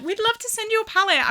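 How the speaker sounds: high, fast cheery brand voice; chirpy brand rep. Retold or imitated. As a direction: quote the brand sweetly upbeat. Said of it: "they were like".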